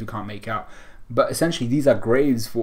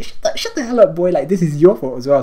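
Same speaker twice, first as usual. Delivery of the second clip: high, loud mock scolding; acted-out indignant shout, pitched way up; exasperated outburst; 7 semitones higher, 8 dB louder than usual. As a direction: tell him off in a raised, squawky mock yell. Acted retort of exasperated Law.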